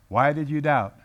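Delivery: normal talking voice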